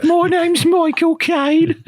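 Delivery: high, drawn-out voice sliding into Liverpudlian; botched Liverpudlian-sounding impression. Friend joining the accent game, slipped Liverpudlian impression. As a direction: stretch it out in a high mock Liverpudlian. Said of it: "That was Liverpudlian".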